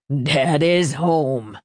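put-on drunken laugh. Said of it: "he laughed".

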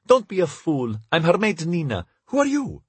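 high, merry maid's voice. A narrator's reading. Maid to Lev who mistook her for the princess, merry. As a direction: lift the pitch high, lightly amused. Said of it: "She laughed merrily".

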